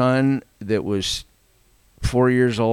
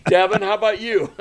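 laughing; 10 semitones higher than usual. Real, not acted. Laughing, handing over to Devin.

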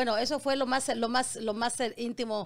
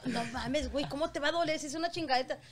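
usual speaking voice

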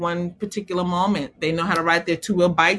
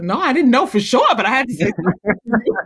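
raised, laughing admission; laughing confession; 4 semitones higher, 3 dB louder than usual. Retold or imitated. Husband owning up, laughing. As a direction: admit it through a laugh. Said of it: "He's like".

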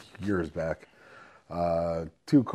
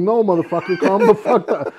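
loud, raised-pitch telling-off with a laugh creeping in; retold angry order; 6 semitones higher, 6 dB louder than usual. To the man in his bear hug, mock-angry order. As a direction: bark it in mock anger, half amused.

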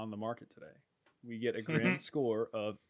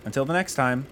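louder, upbeat announcer read; podcast outro; 2 semitones higher, 6 dB louder than usual. Acted. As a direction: sell the outro like a host.

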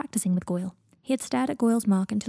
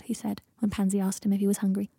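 plain reading voice

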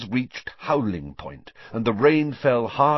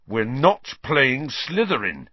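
slow, louder, heated announcement; angry and emphatic, raised pitch; 6 semitones higher, 5 dB louder, slower than usual. A narrator's reading. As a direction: break bad news angrily, weighting each word. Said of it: "looking very angry".

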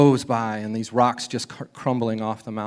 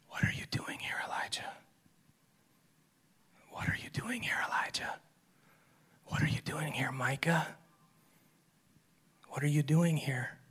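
soft breathy whisper, barely voiced; whispered voice of God; 3 semitones higher, 9 dB quieter than usual. Shown as a demonstration. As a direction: whisper it gently, like God leaning close. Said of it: "That's how I imagine it".

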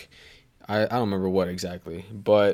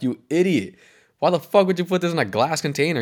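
scolding yell; 6 semitones higher than usual. Retold or imitated. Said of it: "He's like".

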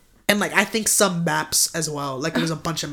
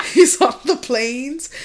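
laughing through the words, higher and louder; 7 semitones higher, 6 dB louder than usual. Real, not acted. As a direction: crack up mid-sentence. Cracks up while telling the story.

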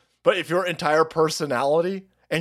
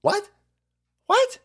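mock bafflement; slower than usual. Feigns bafflement at the slang.

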